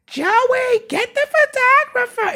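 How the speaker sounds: high, slow, squeaky relative calling out for Joey; hammed-up guest voice, way up high and drawn out; mock relative calling. Wedding guests calling for Joey, mimicked high and slow.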